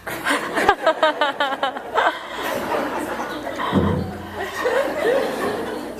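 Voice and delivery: high laughing fit trailing off; long helpless laugh. Real, not acted. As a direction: let the laugh roll out and fade. Wife reads the letter.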